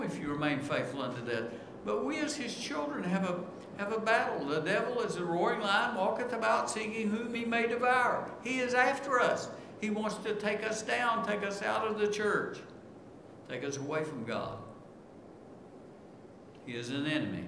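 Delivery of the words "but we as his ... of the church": fervent preaching. Acted. Preacher warning the church about the devil, fervent and raised. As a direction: sound the alarm about the enemy.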